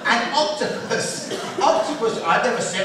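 ordinary talk, nothing marked

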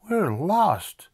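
put-on high, distressed kid voice; pleading boy explaining. A narrator's reading. Arthur to the bus driver, pleading. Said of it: "Arthur explained".

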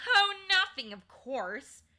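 high, loud, scornful sarcasm; sarcastic. A narrator's reading. Polly answering Digory's protest, sarcastically. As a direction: pounce on it with biting irony. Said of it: "said Polly sarcastically".

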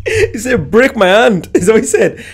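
high, amused double-check through laughter; high-pitched, tickled and incredulous; laughing disbelief. Real, not acted. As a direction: repeat it laughing, checking you heard right.

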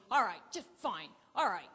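loud, fed-up all right; grudging anger. Retold or imitated. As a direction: bark it out as if giving in angrily. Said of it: "he's storming around".

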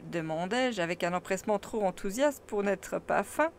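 usual speaking voice

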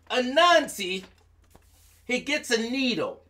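plain reading voice

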